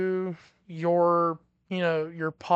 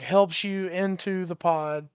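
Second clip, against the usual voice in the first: ordinary talk, nothing marked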